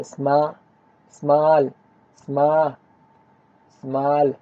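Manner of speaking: स्मा- held alone, then स्मॉल completed after a pause; teacher breaking स्मॉल into parts; syllable breakdown. Shown as a demonstration. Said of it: "इसमें दो syllables है".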